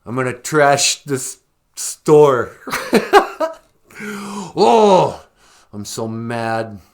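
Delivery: loud, high ranting protester; hammed-up outrage, trash-the-store energy; mock angry protester. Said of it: "I'm angry".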